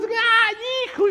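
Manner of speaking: hammed-up emotional outburst; overjoyed cry. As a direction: imitate an aunt crying out on recognising her nephew. Aunt recognises nephew, cries, hugs.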